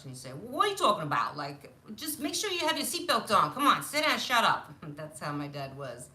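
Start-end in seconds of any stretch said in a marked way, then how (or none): 0.3-4.7 loud, higher-pitched Brooklyn dad impression; brusque, hammed-up Brooklyn accent; harsh Brooklyn accent. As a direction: scold like a gruff Brooklyn father. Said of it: "he had this accent, uh, that was very harsh".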